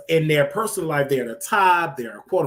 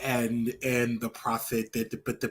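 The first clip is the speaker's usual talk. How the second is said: bishop imitation; 6 semitones lower, 7 dB quieter than usual. Showing the cohost how the bishop talks, mock low mumble. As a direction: imitate a bishop stumbling over his words. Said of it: "look how he talk".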